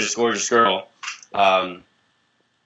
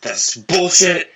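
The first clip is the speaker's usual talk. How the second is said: angry outburst; 6 semitones higher, 5 dB louder, much slower than usual. Retold or imitated. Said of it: "like, kinda angry".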